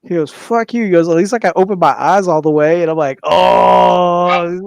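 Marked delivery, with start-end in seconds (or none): 0.3-2.8 higher, louder mock-indignant retort; retold comeback. Supervisor hitting back over hair teasing, mock indignation. 3.2-4.6 long, high, wounded "ohhh"; loud "oh" groan. Retold or imitated. Storyteller reacting to supervisor's jab, loud wounded "oh".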